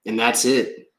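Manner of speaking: usual speaking voice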